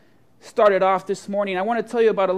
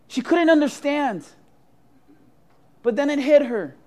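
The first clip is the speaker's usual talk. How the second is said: ordinary talk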